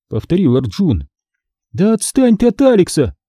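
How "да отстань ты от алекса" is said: high, cutting in; interrupting protest. A narrator's reading. Girl defending Alex from the pestering boy, interrupting. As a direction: break in with a high-pitched rebuke. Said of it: "перебила его Ханна".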